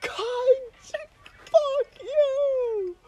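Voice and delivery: very high, slow wailing insults; crying scream. Retold or imitated. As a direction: screech the insults like a drunk in tears. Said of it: "I'm like".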